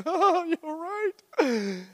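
high, slow dawning "Oh"; drawn out, caught out; slow, high mock sheepish admission; sheepish realisation. Sheepish reply to God.

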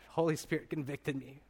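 usual speaking voice

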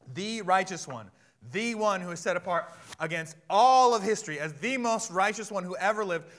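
high, forceful pulpit delivery; full-force preaching. Real, not acted. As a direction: preach it out to the whole church.

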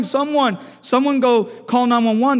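acted emergency cry; yelling for help. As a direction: imitate a father shouting for help. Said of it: "He's yelling".

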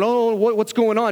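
high, loudish mock bewilderment; flustered latecomer. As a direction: play a late ambulance driver caught off guard. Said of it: "ambulance driver ... showing up late going".

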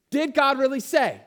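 loud, high, mocking serpent voice; loud tempting serpent sneer; serpent's taunt.

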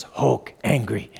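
low growly Hulk toy line, lightly done; a mild imitation of the Hulk toy. Dad voicing the toy's line for the congregation, a low mock Hulk. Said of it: "So he goes".

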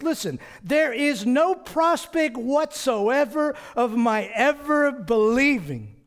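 high, loud, slow emphatic refusal; strongest negation. Acted. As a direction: hammer out every word with total conviction. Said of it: "It is the strongest negation".

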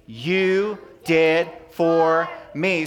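slow, drawn-out call-and-response; broad singsong kids theatre. Shown as a demonstration. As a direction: stretch each word out for the kids to echo. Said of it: "a little louder so I can hear".

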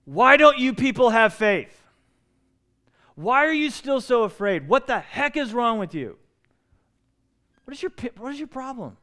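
loud, high, exasperated rebuke; angry Jesus. Shown as a demonstration. Preacher voices Jesus as wrongly heard rebuking fearful disciples, angry. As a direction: scold them at full voice, pitch jumping up. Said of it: "you're hearing anger in Jesus' voice".

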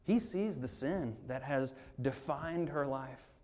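quiet earnest preaching; tender. Real, not acted. Tender climax of sermon.

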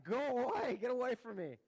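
loud, high-pitched pretend cry of alarm; mock panic. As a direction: shout it as if warding off something terrifying. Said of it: "how paralyzing this would be".

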